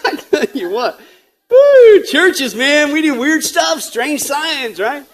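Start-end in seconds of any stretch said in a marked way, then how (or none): 1.5-2.0 high, loud "woo"; whoop. Real, not acted. Preacher winding down after funny church signs, high whoop. Exclaims after a run of jokes.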